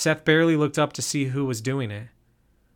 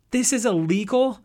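much higher, startled disbelief; shocked question; 9 semitones higher, 4 dB louder than usual. A narrator's reading. Josie reacts to learning the camping is illegal, startled. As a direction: gasp it out in disbelief.

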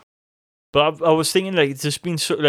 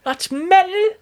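much higher, slow gleeful exclaim; thrilled recognition; 5 dB louder, slower than usual. Retold or imitated. Fan spotting a metal song, much higher gleeful exclaim. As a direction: squeal with delight at the song.

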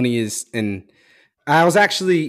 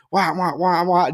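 higher-pitched wah-wah imitation of a rant; mocking nagging noises; 5 semitones higher, slower than usual. Son imitating dad going on in the car, mocking nagging. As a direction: mimic endless nagging with nonsense syllables. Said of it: "just going".

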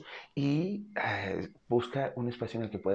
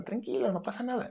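higher, soothing and easy; calm reassurance; 13 semitones higher than usual. Shown as a demonstration. Owner soothing a nervous cat in the car, calm and high. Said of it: "le digo así como".

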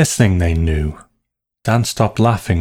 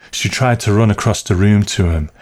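plain reading voice

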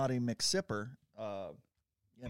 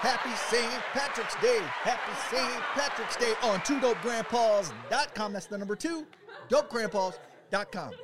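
announcer plug; 6 semitones higher, 4 dB louder than usual. Acted. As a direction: sell the website like a radio spot.

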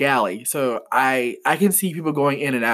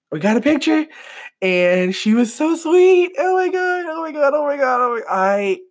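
very high, giddy squeal; high-pitched excitement tumbling into repeats; overjoyed gushing; 12 semitones higher, 3 dB louder than usual. Real, not acted. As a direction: squeal it out like a starstruck fan. Gushing about the picture.

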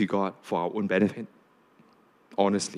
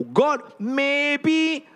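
justifying people; 7 semitones higher than usual. Retold or imitated. Voicing people justifying to God.

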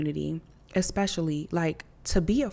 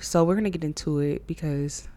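normal talking voice

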